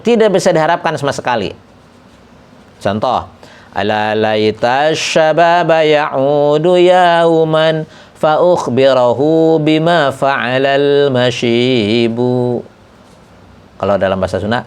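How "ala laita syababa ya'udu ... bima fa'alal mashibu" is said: slow, measured recitation of "Ala laita syababa ya'udu yauman"; recited verse. Acted. Teacher slowly recites an Arabic poem line.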